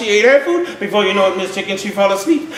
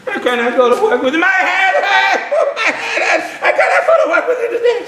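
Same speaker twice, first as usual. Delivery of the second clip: high, laughing moaning excuses; lazy cockroach faking a headache, hammed up; whiny sick cockroach; 4 semitones higher than usual. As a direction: ham up the sickness.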